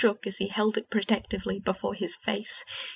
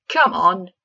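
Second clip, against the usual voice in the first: loud taunting jeer; heckling; 11 dB louder than usual. A narrator's reading. Fred heckling his twin's failed spell, loud taunting. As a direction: goad him on. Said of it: "heckled Fred".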